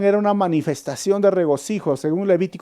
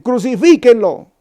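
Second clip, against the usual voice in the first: higher, louder shouted crucifíquenlo; crowd yell; 6 semitones higher, 7 dB louder than usual. Retold or imitated. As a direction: shout it like the angry mob. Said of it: "iban a decir".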